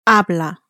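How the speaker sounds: "Habla" stressed on its first syllable. Shown as a demonstration. Tutor shows the changed stress, "Habla" stressed on the first syllable. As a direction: hit "Ha" in "Habla", let "bla" fall away. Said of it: "So the stress has changed".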